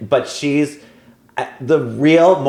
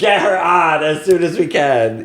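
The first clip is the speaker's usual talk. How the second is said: hyped-up high shout; eager mock producer; 6 semitones higher than usual. Producers eager to bring Phaedra back.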